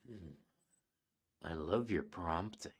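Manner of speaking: breathy whispered come-on; seductive whisper. Retold or imitated. Reads sexy AI line.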